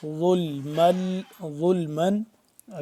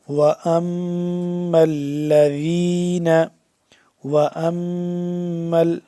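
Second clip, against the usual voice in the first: slow, sustained teaching recitation; measured Quran phrase for pupils; tajweed recitation; 5 dB louder, much slower than usual. Shown as a demonstration. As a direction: chant it with long held sounds. Teacher recites the verse piecemeal.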